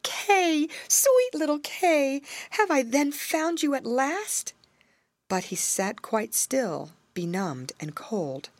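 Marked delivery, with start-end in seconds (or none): 0.0-4.5 calling out to a lost friend, high; joyful cry. A narrator's reading. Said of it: "and cried out".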